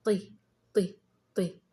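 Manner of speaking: طي as a separate emphatic "ti"; each طي on its own, with an i vowel; letter with kasra. Shown as a demonstration. Teacher showing how kasra is pronounced, separate طي. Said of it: "وينطق هكذا".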